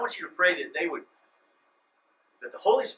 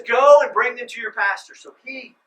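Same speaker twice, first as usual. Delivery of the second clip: loud, higher mock decree; mock command; 6 semitones higher, 13 dB louder than usual. Pastor mocking a wrong reading of Jesus, loud. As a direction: boom it out like a false command. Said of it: "He says".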